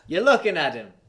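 high, loud Jamaican character voice; Jamaican accent. Acted. Podcaster acts a sled team scene, heightened Jamaican accent. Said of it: "I gotta do an accent and a character".

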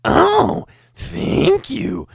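extremely high squeaky gremlin thanks. Retold or imitated. Customer's thanks turned gremlin, falsetto squeak. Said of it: "'cause you made".

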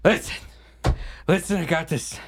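winded, broken phrases between gasps; panting, halting delivery; out of breath. Acted. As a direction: force the news out while catching your breath. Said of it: "Oh, God, I'm out of shape".

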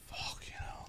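breathless whisper; whispered reaction to the fit summoner; whispered awe. Real, not acted. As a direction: whisper it, impressed. Reacting to attractive character.

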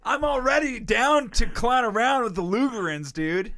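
loud, high goofy hype; hyped joke. Pretend. Joking to his friend about Lutherans, loud hammed-up eagerness. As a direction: ham up the eagerness.

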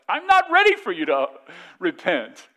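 very high, loud comic protest; mock exasperation. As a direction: act out an over-the-top indignant refusal. Acted spouse rejecting repentance.

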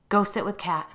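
firm order. A narrator's reading. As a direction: send her off with a brisk order. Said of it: "I ordered Claire".

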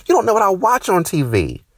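high, loud exasperated shout; angry outburst. Real, not acted. Comic to a coworker, high exasperated shout.

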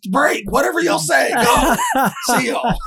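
high, loud coach shout; mock coach. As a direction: holler it like the coach. Coach quote retold, shouting.

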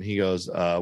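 ordinary delivery

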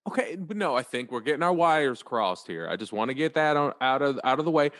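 ordinary talk, nothing marked

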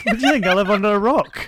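loud, high, laughing protest; playfully outraged at being underestimated; mock indignation. Real, not acted. Mock indignation at friend's surprise.

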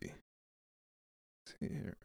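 slow whispered murmur while searching the screen; a real under-the-breath mumble, drawn out; whispered to self. Real, not acted. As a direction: breathe it to yourself, eyes on the page. Muttering while searching his screen.

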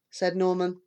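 ordinary delivery

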